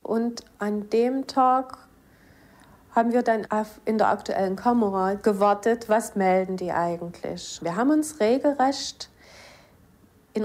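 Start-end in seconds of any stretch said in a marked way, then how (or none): none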